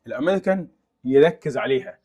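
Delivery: ordinary talk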